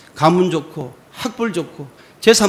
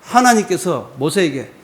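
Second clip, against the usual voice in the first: normal talking voice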